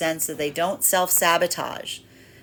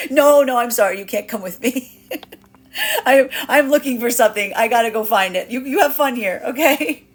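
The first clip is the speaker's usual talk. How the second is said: higher, louder teasing voice; playful feminine brush-off; 5 semitones higher, 6 dB louder than usual. Acted. Feminine energy brushing off a lost masculine, playful and higher. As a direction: toss it off brightly, dodging him. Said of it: "this is the feminine going".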